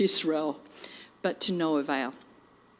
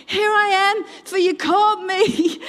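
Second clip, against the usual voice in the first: put-on high, loud child's voice; eager boy; 12 semitones higher, 6 dB louder than usual. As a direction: pipe up high and loud, reporting for duty. Voices boy Samuel running to Eli.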